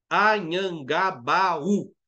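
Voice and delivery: "Anhangabaú" very slowly as a-nhan-ga-ba-ú; syllable by syllable. Shown as a demonstration. Teacher showing a hiatus, "Anhangabaú" slowly broken into syllables. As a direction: draw out "Anhangabaú" with the final U standing alone. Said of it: "O U sozinho na sílaba".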